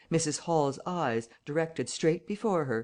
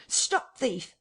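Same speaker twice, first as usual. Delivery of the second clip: high-pitched urgent call; cry of alarm; 10 semitones higher than usual. A narrator's reading. Huxter chasing a thief, crying out high in alarm. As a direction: cry out after a fleeing thief. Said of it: "cried Huxter".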